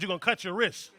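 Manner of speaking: ordinary talk, nothing marked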